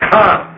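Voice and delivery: the word "Come" set apart and lifted; emphasis on come. Shown as a demonstration. Preacher singles out the word "Come" for the congregation, lifting it. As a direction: lift "Come" and let it stand alone. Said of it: "That little teeny tiny word".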